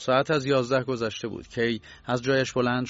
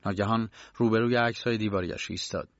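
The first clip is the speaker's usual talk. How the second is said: ordinary delivery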